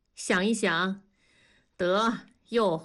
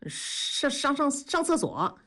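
stuttered, higher-pitched fluster; stammering excuse; 6 semitones higher than usual. A narrator's reading. Husband makes an excuse to his wife, stammering. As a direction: stumble on the first sound, then blurt it.

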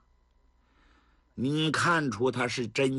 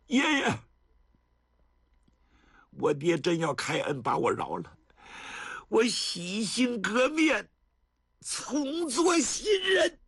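a high, slow, ringing vow at the end; earnest vow; 9 semitones higher, slower than usual. A narrator's reading. Penitent grandson vows to his grandfather, crying out. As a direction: cry out the promise to change. Xue Dingshan vows to reform.

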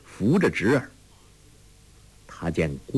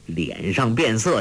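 ordinary delivery, nothing marked

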